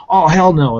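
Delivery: a flat-out rejection; indignant viewer. Retold or imitated. Viewer after watching the video, loudly refusing. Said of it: "and they'll be like".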